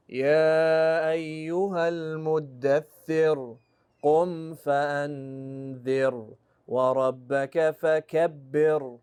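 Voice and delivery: يا أيها المدثر, فأنذر, فكبر all with strong heavy Ra; slow recitation pressing every Ra heavily. Shown as a demonstration. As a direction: chant the verses slowly, each Ra heavy. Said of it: "That's all heavy".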